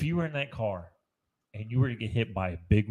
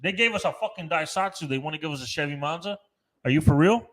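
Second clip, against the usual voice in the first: indignant rant; 4 semitones higher, 7 dB louder than usual. Real, not acted. Outraged rant over missing car.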